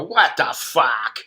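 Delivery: slow, drawn-out incredulous what the fuck; stunned disbelief. Retold or imitated. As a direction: drag it out like you can't believe the news. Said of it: "and I go".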